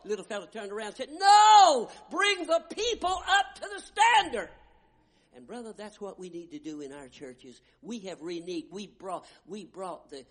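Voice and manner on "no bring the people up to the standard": very high, loud ringing reply; defiant shout. Retold or imitated. Little flag bearer shouting his defiant answer to the commander. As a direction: shout back the battle cry.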